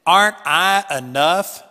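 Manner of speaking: loud, booming question from above. Retold or imitated. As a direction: ask it like God shaking the ground. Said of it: "booming voice said".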